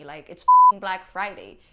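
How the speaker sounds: loud, fed-up vent; exasperated outburst. Real, not acted. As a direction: blurt it out in frustration.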